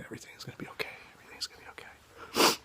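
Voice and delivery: full whisper, soothing and hushed. Retold or imitated. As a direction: whisper it beside a frightened patient's bed.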